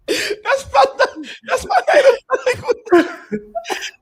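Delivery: high, helpless laughing speech. Real, not acted. Host after joking in tongues, laughing. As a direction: barely get the words out through laughter.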